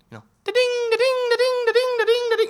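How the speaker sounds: sing-song ding-ding up high, like a phone alert; notification ding. Pretend. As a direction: chime it like a doorbell going off again and again. Crowd arriving like a notification.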